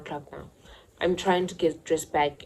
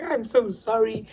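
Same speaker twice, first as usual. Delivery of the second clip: mock crying apology; 6 semitones higher, 3 dB louder, slower than usual. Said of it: "I'm like".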